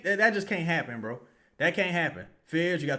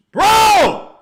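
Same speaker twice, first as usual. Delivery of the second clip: one loud, much higher cry; anguished shout; 13 dB louder than usual. Real, not acted. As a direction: yell the word in disbelief. Anticipating opponent scoring.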